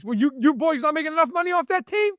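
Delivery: high, sneering mock question to the owners; mocking the team owners.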